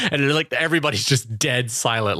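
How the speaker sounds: ordinary delivery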